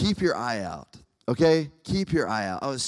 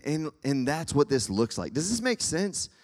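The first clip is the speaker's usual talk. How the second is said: ordinary delivery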